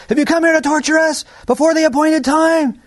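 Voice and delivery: high, loud demon cry; possessed men screaming at Jesus; demons shouting. Acted. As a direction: shriek it at the Son of God. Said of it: "they shouted".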